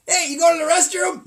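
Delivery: mock audience member.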